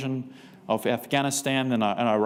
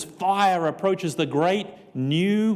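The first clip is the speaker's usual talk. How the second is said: dramatic reading; 5 semitones higher, much slower than usual. Retold or imitated. Reading prophecy slowly, dramatic.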